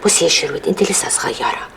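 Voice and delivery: calm her down gently; mother soothing daughter quietly; soft soothing. Acted.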